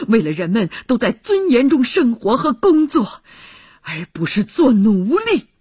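loud, forceful outburst, rising on key words; impassioned roar. Acted. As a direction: jolt a comrade into hearing what the fight is for. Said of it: "吼道".

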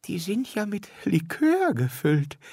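put-on high, surprised old lady voice; old lady delighted at liqueur chocolates. A narrator's reading. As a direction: squeak it out like a delighted old woman. Frau Peters voiced in story.

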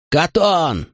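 bellowed name over the brawl; yelling. A narrator's reading. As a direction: yell across the fight. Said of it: "орал Макрон".

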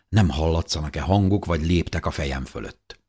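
usual speaking voice

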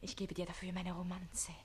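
soft, breathy offer; conspiratorial whisper. Acted. Page bribing Susanne, conspiratorial whisper. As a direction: whisper a secret deal. Bargaining secretly.

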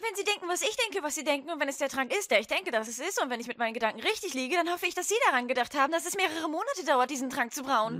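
eager know-it-all. Acted. Hermine rushes know-it-all speech.

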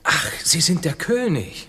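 lauter, langsamer überraschter Ausruf; plötzlich begreifen und staunen. Acted. Kolumbus erkennt den König. (Kolumbus erkennt verblüfft den König)

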